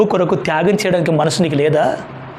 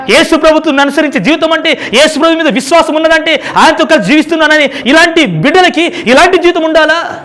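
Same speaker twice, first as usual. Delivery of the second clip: high, loud impassioned preaching; preaching at full force; 10 semitones higher, 7 dB louder than usual. Acted. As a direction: press the point hard on the brothers and sisters. Preacher challenges the congregation. (ఉద్వేగంతో బోధించడం)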